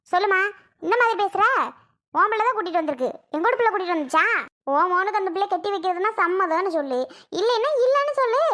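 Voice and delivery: rising to a high shout at the end; heated quarrel, ending in a high yell; angry demand. Acted. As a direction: press her hard for a yes or no. Heated family quarrel, demanding answer.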